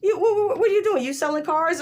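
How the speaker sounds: high, exasperated disbelief at the dad; incredulous. Retold or imitated. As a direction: scold him in high outrage, half laughing. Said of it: "I'm like".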